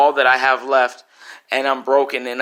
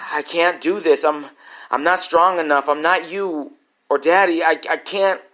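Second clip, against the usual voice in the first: higher, pleading, breaking; desperate refusal; 5 semitones higher, 3 dB louder than usual. Retold or imitated. Son refusing his dying mother's request, desperate pleading. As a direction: refuse through rising panic.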